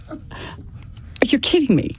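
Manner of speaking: high, breathless half-whispered disbelief; stunned disbelief. Retold or imitated. Wife hearing her husband shot himself, stunned half-whisper. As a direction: gasp it out, stunned. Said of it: "And I just kinda went".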